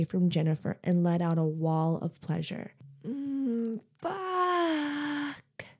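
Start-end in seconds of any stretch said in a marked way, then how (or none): 3.1-5.7 moaning. Acted. Wail of pleasure.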